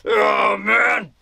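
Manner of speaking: groaning, as if dazed; groggy. Acted. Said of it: "is he freaking groggy".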